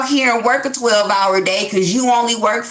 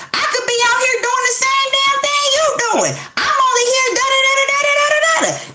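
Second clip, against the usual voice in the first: much higher, shrieking, tearful rant; screaming fed-up partner. Acted. An unhappy partner finally explodes at their mate, shrieking. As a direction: let it all out like you've had enough.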